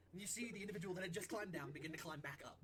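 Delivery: usual speaking voice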